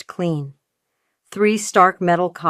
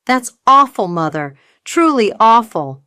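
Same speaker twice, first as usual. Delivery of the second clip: mock sympathy; 5 semitones higher, 5 dB louder than usual. Narrator feigning sympathy.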